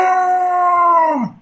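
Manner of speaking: loud, high guttural orc roar; orc impression. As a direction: bellow it like a war-crazed orc. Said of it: "this is my impression of an orc".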